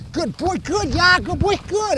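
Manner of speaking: high, bouncy praise; excited dog praise. Real, not acted. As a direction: cheer the dog on in play. Praising dog during play.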